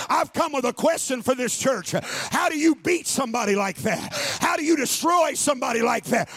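rally the church, climbing to a roar; full-force preaching. Acted. Pastor rallying his church to rise up, shouting.

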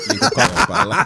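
words squeezed out through laughter; laughing. Real, not acted. Friend finishing his crude joke, laughing through it. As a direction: finish the line while still cracking up.

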